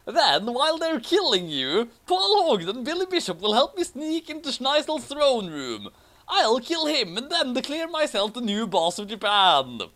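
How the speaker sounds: high, grandiose villain-hero scheming; hammed-up hero voice. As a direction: announce the evil plan like a cartoon hero. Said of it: "Awesome said intelligently".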